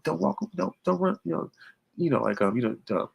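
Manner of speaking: high-pitched mock yell; pleading falsetto call; imitated yelling. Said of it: "Naomi was yelling Bianca's name".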